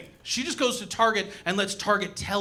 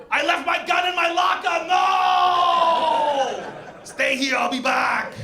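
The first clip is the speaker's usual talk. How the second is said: high, loud urgent shout; frantic Terminator; 10 semitones higher, 4 dB louder, slower than usual. Retold or imitated. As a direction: yell the warning in a panic. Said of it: "He's like".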